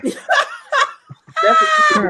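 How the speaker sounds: high, slow, laughing delivery; laughing punchline. Real, not acted. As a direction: squeal it through laughter.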